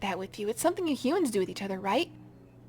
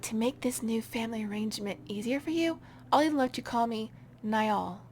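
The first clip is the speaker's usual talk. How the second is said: ordinary talk, nothing marked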